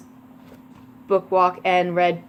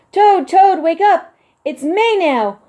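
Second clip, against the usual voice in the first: excited calling; 10 semitones higher, 7 dB louder, slower than usual. Acted. Frog excitedly wakes Toad.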